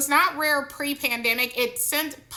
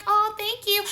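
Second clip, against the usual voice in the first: higher polite thank-you; mock polite reply; 9 semitones higher than usual. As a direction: play your own sweet politeness. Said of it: "I'm like".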